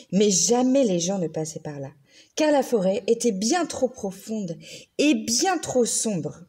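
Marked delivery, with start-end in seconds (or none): none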